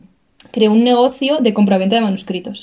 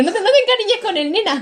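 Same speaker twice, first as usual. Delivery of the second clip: mock parent; 10 semitones higher than usual. Voicing old-time parents.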